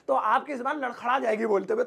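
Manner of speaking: ordinary delivery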